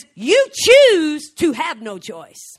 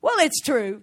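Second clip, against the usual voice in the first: ordinary talk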